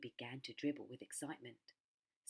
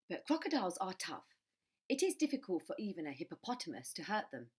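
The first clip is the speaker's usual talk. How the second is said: usual speaking voice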